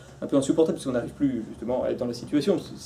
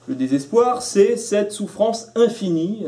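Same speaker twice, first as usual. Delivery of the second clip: very slow and high, every word stretched; drawn-out declamation; 6 semitones higher, 3 dB louder, much slower than usual. Real, not acted. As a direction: draw it out like endless suffering. Dramatic definition of despair.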